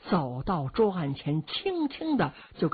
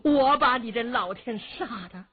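loud curse rising much higher; furious old lady; 5 semitones higher, 4 dB louder than usual. A narrator's reading. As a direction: curse the old man in fury. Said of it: "老太太气坏了".

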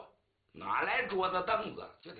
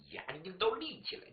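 plain reading voice

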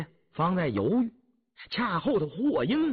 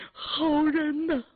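tearful cry; 13 semitones higher, slower than usual. A narrator's reading. Grateful old woman weeps.

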